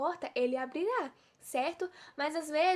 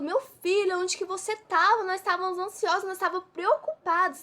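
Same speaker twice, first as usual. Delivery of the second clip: anxious parents; 5 semitones higher, 6 dB louder than usual. Retold or imitated. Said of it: "chegou aflito".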